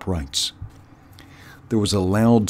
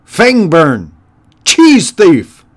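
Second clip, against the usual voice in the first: high, loud yelled roll call; 9 semitones higher, 11 dB louder, slower than usual. Acted. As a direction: summon underlings with a shout. Warlord calls out henchmen by name.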